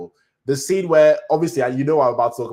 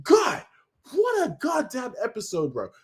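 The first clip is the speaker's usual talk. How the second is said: overwhelmed rave; 10 semitones higher than usual. Retold or imitated. Recalling scene, overwhelmed.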